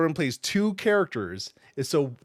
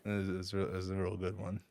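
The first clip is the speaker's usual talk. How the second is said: mock deep voice; 8 semitones lower, 12 dB quieter than usual. Mock deep echo of joke.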